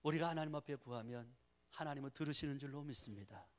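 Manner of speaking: ordinary delivery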